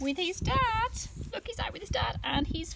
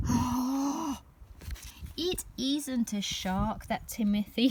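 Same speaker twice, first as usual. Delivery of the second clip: ordinary delivery, nothing marked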